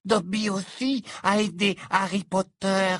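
high-pitched squeaky little creature; squeaking house-elf. A narrator's reading. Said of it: "couina-t-il".

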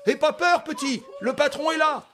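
loud, high reassuring call; shouting from the crowd. Acted. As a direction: shout across the courtroom to calm him.

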